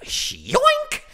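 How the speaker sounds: squeaky, very high loud yoink; snatch it with a cartoon squeal; hammed-up cartoon yoink; dramatic yoink. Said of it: "snatched a comment card as dramatically".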